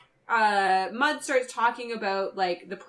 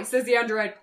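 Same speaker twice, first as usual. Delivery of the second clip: normal talking voice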